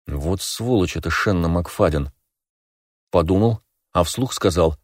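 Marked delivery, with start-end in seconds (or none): none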